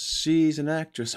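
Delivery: ordinary talk, nothing marked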